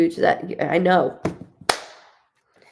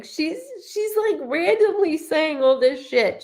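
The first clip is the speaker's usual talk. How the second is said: laughing disbelief; 9 semitones higher than usual. Real, not acted. Amused exclaiming about friend.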